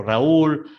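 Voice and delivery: "Raúl" in two syllables, Ra-úl, stronger on ú; broken diphthong. Shown as a demonstration. Teacher listing hiatus examples, "Raúl" with a stressed separate ú. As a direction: punch the ú of "Raúl" as its own syllable. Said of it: "se rompe el diptongo".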